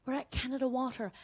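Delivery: soft, hushed apology; nice man waking someone. Retold or imitated. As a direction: speak softly to rouse a sleeping stranger. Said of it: "some nice man taps me".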